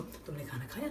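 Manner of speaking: low, hushed question as if checking on a partner; acted gentle check-in; soft concerned question. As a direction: ask quietly, lifting at the end. Acts out asking the upset girlfriend.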